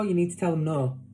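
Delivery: ordinary talk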